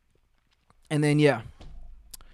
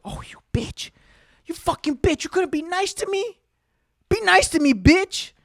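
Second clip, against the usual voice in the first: whiny hurt retort. Retold or imitated. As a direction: protest in a squeaky, hammed-up sulk. Retorts to the angry lady.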